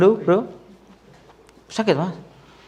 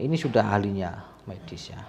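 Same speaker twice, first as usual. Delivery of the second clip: whispered aside; 4 semitones lower, 9 dB quieter than usual. Real, not acted. Aside to viewers.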